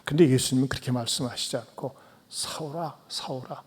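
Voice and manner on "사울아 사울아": much softer, gentle pleading; Jesus gently appealing to Saul instead of shouting. Acted. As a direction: plead softly, like the one who was hurt. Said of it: "마치 호소하는 듯이 말씀을".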